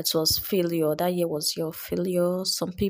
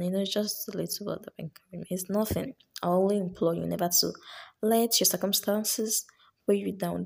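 usual speaking voice

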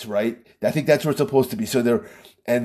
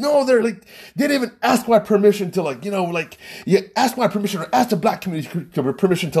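higher-pitched mock indignation; pissed off; 7 semitones higher, faster than usual. Friend complains nobody asked his permission, mildly pissed off. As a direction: lightly imitate an aggrieved friend, pitched up. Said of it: "he was just pissed".

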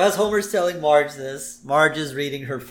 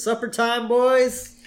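Ned calling out; 11 semitones higher, 6 dB louder, much slower than usual. Retold or imitated.

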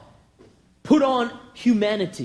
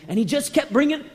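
ordinary delivery

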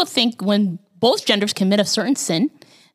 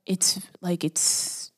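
whisper; 3 semitones lower, 9 dB quieter, much slower than usual. Real, not acted. Drops to whisper mid-thought.

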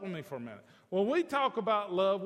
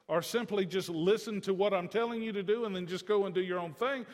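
ordinary talk

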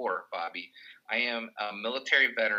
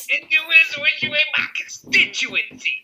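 hammed-up politician; 10 dB louder than usual. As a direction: bellow it to the crowd like an old campaigner. Repeating the film's politician line.

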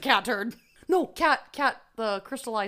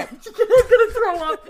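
high, slow, laughing disgust; 10 semitones higher, 9 dB louder, slower than usual. Real, not acted. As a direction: laugh while gagging.

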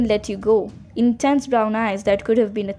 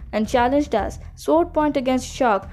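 ordinary delivery, nothing marked

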